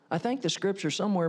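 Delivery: ordinary talk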